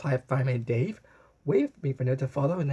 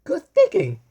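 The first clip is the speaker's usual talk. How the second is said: much higher and louder cheer; excited exclamation; 14 semitones higher, 10 dB louder than usual. A narrator's reading. Frederica cheering Blue's idea, high and loud. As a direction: shout your approval. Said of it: "exclaimed Frederica".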